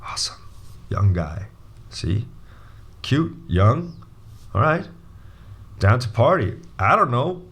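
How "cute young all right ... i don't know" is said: ordinary talk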